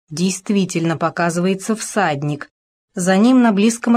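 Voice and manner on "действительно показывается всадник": ordinary delivery, nothing marked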